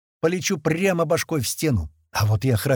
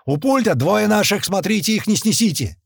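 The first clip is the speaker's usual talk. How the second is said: high, urgent, raised voice; shouted warning; 7 semitones higher, 4 dB louder than usual. A narrator's reading. Warning workers about his two men, shouting. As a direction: warn the crowd loudly.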